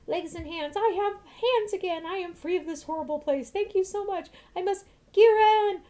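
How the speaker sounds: high, loud, overjoyed thanks; joyful freed woman. Acted. Freed woman thanking her rescuers, high and elated. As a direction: burst out with relief at being free.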